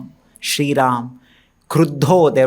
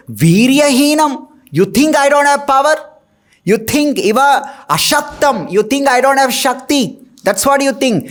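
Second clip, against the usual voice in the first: higher, indignant challenge; defiant retort; 7 semitones higher than usual. Retold or imitated. Young Ram answers Parashuram's challenge, indignant and higher. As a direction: throw the doubt back at him, pitched up.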